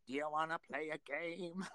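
a hint of an elderly killer on the phone; old Ghostface voice. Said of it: "'cause he is like".

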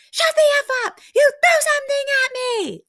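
imitated scream, very high and loud; full-throated mock outburst; retold screaming. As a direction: shriek it like someone in a screaming match. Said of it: "Like, screaming".

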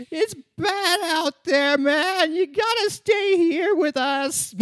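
high, slow pleading voice; high whiny voice. Acted. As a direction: coax him to stay, high and slow. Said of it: "Your limbic system comes like".

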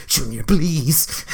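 low, drawn-out imitation of mom; pleading mom. Mom stepping in to calm Junior, slow mock plea. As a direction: plead slowly, keeping it down. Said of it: "Mom always has to come, and then she's like".

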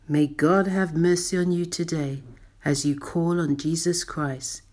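ordinary delivery

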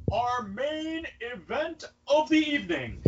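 high, softly stretched mock announcer call; ring announcer. Host introducing the show's main event, high drawn-out mock announcer voice. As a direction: draw out each word like an arena announcer heard softly. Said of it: "Don't know why I'm talking like this".

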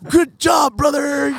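high, shouted over-the-top praise; excited shout. Pretend. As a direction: scream it like a proud fan. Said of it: "hug 'em and celebrate".

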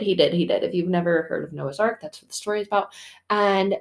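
ordinary delivery

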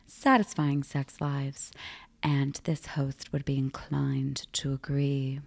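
slow, breathy sultry whisper. Acted. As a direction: whisper it like a secret aside. Sultry aside in intro.

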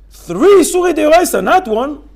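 high, loud outcry; loud emphatic. Shown as a demonstration. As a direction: hammer home the count. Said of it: "repeats with force".

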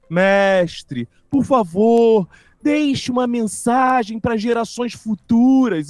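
slow, solemn disciple's question; slow reverent plea. Retold or imitated. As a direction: draw it out like a devoted disciple. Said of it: "devagarinho, aproximando". (discípulo pedindo devagar e reverente)

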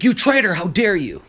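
high, loud retold accusation; imitated furious accuser, pitch soaring then dropping; mock outrage. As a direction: cry betrayal, hammed-up. Retold accusation during an attack.

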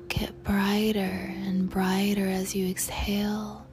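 usual speaking voice

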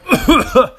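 loud, high retching cough; imitated choking. Trainee retelling his second yell, imitated choking. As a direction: act out dry heaving and choking. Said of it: "dry heaving, almost throwing up, choking".

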